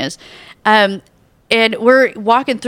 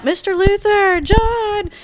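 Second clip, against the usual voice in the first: high called-out name; calling out; 11 semitones higher, slower than usual. Retold or imitated. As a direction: call his name across the street. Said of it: "people are yelling out".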